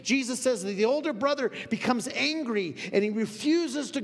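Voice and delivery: loud, high, driving sermon voice; full-force preacher pouring it out to the congregation; fiery preaching. Acted. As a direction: preach it to the back row.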